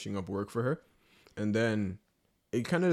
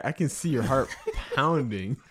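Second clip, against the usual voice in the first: laughing through the words; amused, laugh bursting in before the last word; laughing tease; 3 semitones higher than usual. Real, not acted. Teasing a nervous guest, laughing.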